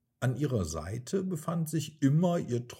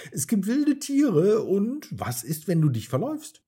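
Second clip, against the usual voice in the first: higher and louder, fearful question; monkey frightened about the forest; fearful cry; 9 semitones higher, 7 dB louder than usual. A narrator's reading. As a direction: blurt the danger out in fear. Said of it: "rief Miko ängstlich".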